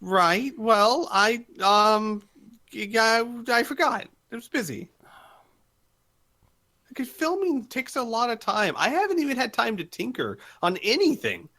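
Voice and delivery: high-pitched, halting excuses; acted defensive fluster; flustered excuse-maker. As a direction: make sheepish excuses in a high voice. Voices a character making excuses.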